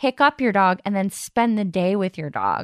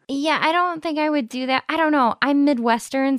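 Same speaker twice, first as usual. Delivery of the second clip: ordinary talk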